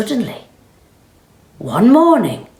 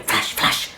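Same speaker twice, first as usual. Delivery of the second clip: whispered camera flashes; 4 dB quieter than usual. Acted.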